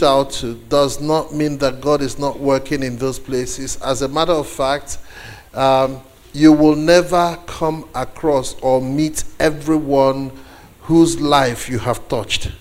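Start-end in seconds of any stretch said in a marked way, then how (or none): none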